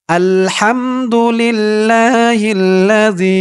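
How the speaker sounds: slow, drawn-out chanted recitation; recited Arabic dua. Shown as a demonstration. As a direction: stretch every syllable like tilawat. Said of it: "দোয়াটি প্রথমে আরবিতে আমি আপনাদেরকে শেখাবো". (ধীর সুরেলা তিলাওয়াত)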